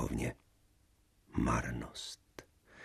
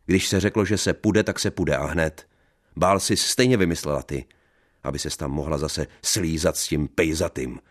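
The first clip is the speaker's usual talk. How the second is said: angry shouting; 3 dB louder than usual. Acted. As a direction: yell it at her. Jealous husband yelling. (žárlivý křik)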